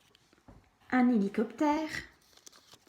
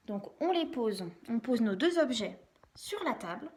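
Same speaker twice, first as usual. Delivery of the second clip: normal talking voice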